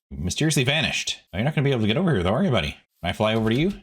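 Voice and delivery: ordinary talk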